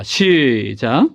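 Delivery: very drawn-out first syllable; reading cue. Real, not acted. Preacher starting the congregation reading together, drawn-out cue. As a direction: stretch 시작 into a long group cue.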